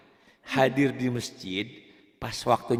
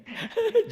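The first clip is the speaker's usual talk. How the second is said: high laugh. Real, not acted. Teacher amused by the student's slip, high laugh. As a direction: burst of high-pitched laughter.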